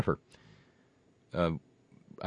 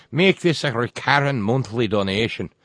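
louder, hammed-up Irish brogue; crummy Irish accent; 3 semitones higher, 6 dB louder than usual. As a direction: read "Make this a recurring monthly donation" in a mock Irish lilt. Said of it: "my crummy Irish accent yet".

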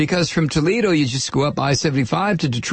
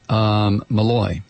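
low, hesitant answer to an interrogator; questioned passenger; 7 semitones lower, slower than usual. Acted. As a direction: answer the officer low and uneasy.

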